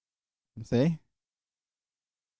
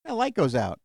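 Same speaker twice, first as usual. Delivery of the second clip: ordinary delivery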